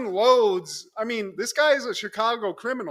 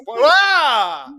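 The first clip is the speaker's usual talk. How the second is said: sudden loud yelp of shock; a quick hammed-up scream of surprise; startled yell; 8 semitones higher, 11 dB louder than usual. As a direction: cut off by a startled shout. [name] startled mid-greeting.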